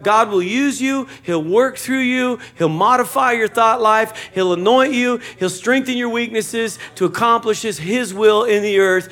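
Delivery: a pastor building to the peak of his sermon; rising preaching climax. Acted. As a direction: lift the room with each promise.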